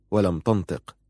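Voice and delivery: usual speaking voice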